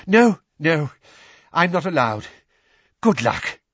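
loud, breathless refusal; winded urgency. Acted. Weasley sending Harry in alone, out of breath. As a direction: puff it out hurriedly.

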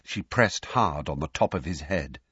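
ordinary delivery, nothing marked

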